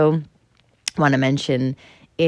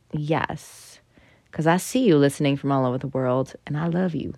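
ordinary talk

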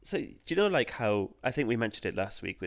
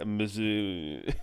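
slow, drawn-out Stallone mumble; Rocky impression; much slower than usual. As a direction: drag out mosaic like Rocky. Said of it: "He sounded like Rocky".

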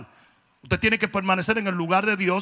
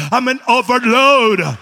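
slow, louder drawn-out English; overwhelmed gringo; 3 semitones higher, 13 dB louder, much slower than usual. Retold or imitated. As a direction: stretch out the overwhelmed American's words. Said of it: "un gringo ... y dijo". (gringo abrumado, lento y fuerte)

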